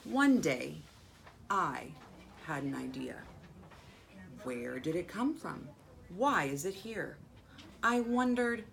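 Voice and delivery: measured read-aloud with long pauses between phrases; storybook reading with lifted key words; story narration. A narrator's reading. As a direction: read a picture book to children, phrase by phrase. Reads picture book aloud to children.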